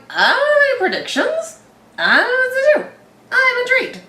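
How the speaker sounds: high-pitched helpless self-quote; hammed-up high bewilderment; mock bewilderment. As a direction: throw it up high, comically at a loss. Acts out their helpless episode endings.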